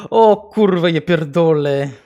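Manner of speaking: loud, put-on Polish curse; mock Polish swearing. To the chat after guessing Polish, a loud mock Polish curse. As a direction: swear like a fed-up Pole, loudly. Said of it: "Polish is the closest accent".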